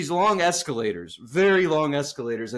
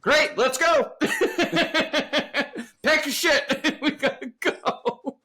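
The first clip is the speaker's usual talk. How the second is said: high, laughing through the words; giggling mock hurry; 11 semitones higher, slower than usual. Real, not acted. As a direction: crack up as you say it. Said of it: "I was like".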